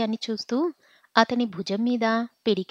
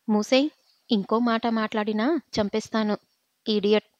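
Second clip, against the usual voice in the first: usual speaking voice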